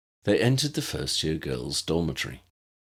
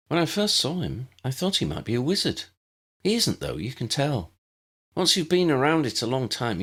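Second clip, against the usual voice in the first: lightly voiced schoolgirl, higher and explaining kindly; a patient older pupil showing the new girl around; Tessa the friendly older student; 7 semitones higher than usual.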